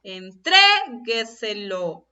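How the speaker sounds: Entrégueselo very slow, "tré" stretched long; stress on TRE. Shown as a demonstration. Teacher shows the accent, "tré" stretched in Entrégueselo. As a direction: exaggerate the held "tré" in Entrégueselo. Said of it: "En la sílaba tre".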